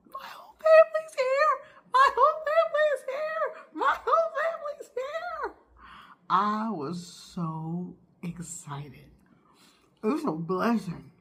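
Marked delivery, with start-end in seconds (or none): 0.1-5.5 high, loud squealing with laughter; joyful screaming, much higher; excited screaming. Retold or imitated. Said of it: "I ran around the house screaming". 6.3-9.1 slow whispered afterthought; slow soft whisper. Real, not acted. Breathless whisper after screaming.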